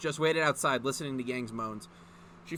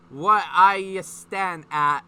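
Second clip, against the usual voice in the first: loud, slow, muffled through a gag; slow loud garbled words round a gag; mouth full of cloth; 3 semitones higher, 11 dB louder, much slower than usual. A narrator's reading. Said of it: "managed to say with her mouth full of cloth".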